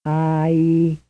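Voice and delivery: held pure vowel sound; sustained vowel. Shown as a demonstration. Teacher demonstrating a lone vowel. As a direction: sing out one long vowel.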